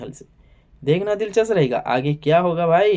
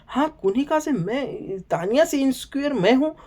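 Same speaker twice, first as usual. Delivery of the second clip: mocking Kunika; 8 semitones higher, 5 dB quieter than usual. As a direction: squeak it out like a sulky diva. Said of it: "वो बोले".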